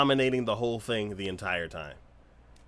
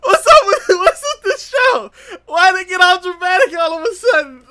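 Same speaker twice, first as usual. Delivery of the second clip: high, loud, hammed-up tearful shout; distraught protest; 18 dB louder than usual. Viewer upset by sudden drama, distraught protest. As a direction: wail in disbelief at the drama.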